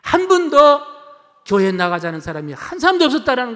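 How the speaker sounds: high, loud indignant complaint; loudly imitating an angry pharmacist; retold angry outburst. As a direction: act out a pharmacist blowing up. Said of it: "버럭 화를 내더랍니다".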